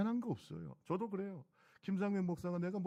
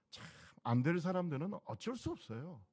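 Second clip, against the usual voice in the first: ordinary talk, nothing marked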